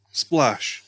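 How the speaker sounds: plain reading voice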